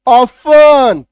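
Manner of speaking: "ऑफन" called out loud, no t; silent t. Shown as a demonstration. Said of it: "टी को काट दो". ("ऑफन" ज़ोर से, बिना टी के)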